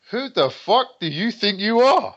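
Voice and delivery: high-pitched indignant retort, played up; mock outrage. Fighter retelling his reaction to the girl's snub, mock outrage. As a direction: replay wounded pride as comic indignation. Retold outrage at being rejected.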